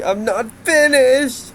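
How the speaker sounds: high, slow, loud character quote; imitated game line. Quoting the strange voice clip, high slow mock protest. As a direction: draw it out high and insistent. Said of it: "this one's kinda strange, but, but see".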